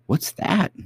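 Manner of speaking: put-on high puzzled girl voice; cartoon princess. A narrator's reading.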